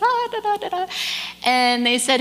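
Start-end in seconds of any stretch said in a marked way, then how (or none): 0.0-0.9 high, happy-go-lucky nonsense syllables; carefree kid. Retold or imitated. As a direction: imitate a cheerfully oblivious kid, pitched way up. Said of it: "He's still smiling at this point".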